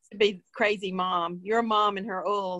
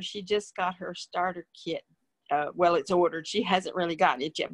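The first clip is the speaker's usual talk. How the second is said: ordinary talk, nothing marked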